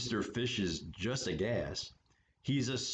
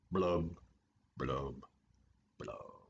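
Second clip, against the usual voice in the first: blub blub blub, dropping lower and softer to nothing; sad fading blubs; 10 semitones lower, 4 dB quieter, slower than usual. Acted. Gloomy fish's blubs fading out after his refrain. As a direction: let each blub sink and die away.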